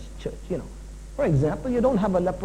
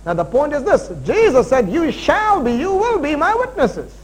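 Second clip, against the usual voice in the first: energetic, raised, punchy scripture preaching; full-force preaching; 4 semitones higher, 5 dB louder than usual. Real, not acted. Preacher urging the church to be witnesses, full-force preaching. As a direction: hammer the key words of the verse.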